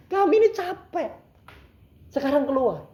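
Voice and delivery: high-pitched, fed-up cry; exasperated outburst. Retold or imitated. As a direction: re-enact losing patience with the spirit. Weary exorcist orders the spirit out. (seruan kesal bernada tinggi)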